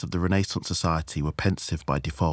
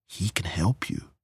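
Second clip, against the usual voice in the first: hushed coaxing. Acted. Chairwoman reassures the tearful hostess, hushed. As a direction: coax gently, lifting at the end.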